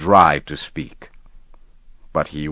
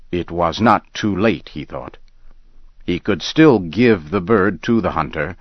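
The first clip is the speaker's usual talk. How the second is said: ordinary delivery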